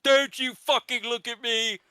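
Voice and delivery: high, loud, drawn-out mock yell; angry skit quote. Podcaster imitates the skit man snapping at kids, mock yell. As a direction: imitate the character's outburst, slow and high. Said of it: "he's like".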